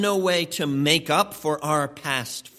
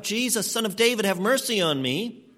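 higher-pitched, restrained imitation of calling out; retold calling out; 6 semitones higher than usual. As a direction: call out for mercy, without really yelling. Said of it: "And so he calls out".

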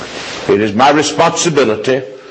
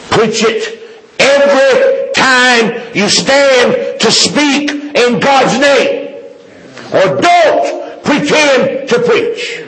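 very high, slow, drawn-out exhortation; impassioned preaching; 13 semitones higher, slower than usual. Real, not acted. Preacher charging fellow preachers to preach, high impassioned cry. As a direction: pitch soaring, stretch out each word as a charge.

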